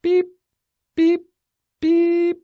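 short high beeps, one by one; imitated keypad beeps. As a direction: tap out each beep like a keypad.

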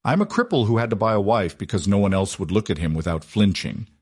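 ordinary delivery

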